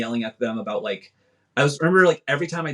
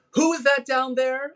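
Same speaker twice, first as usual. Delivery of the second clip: high, slow call from a distance; the director squints down at the field and calls out; calling down from the box; 12 semitones higher, slower than usual. Retold or imitated. Director calling down to the field.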